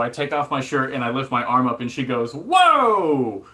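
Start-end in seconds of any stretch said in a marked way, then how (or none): 2.3-3.5 loud high startled whoa; high, loud shocked exclamation. Retold or imitated. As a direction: gasp of alarm at the sight. Doctor's shocked reaction quoted.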